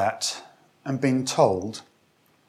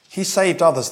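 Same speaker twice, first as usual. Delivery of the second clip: raised, louder jeer read from scripture; mocking; 5 semitones higher, 7 dB louder than usual. A narrator's reading. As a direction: read it as a mild taunt from the elders. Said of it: "the elders mocked him".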